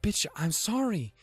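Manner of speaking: high, rushed fake apology; high, hammed-up defensive rambling; mock defensive excuse. Defending himself in retold exchange.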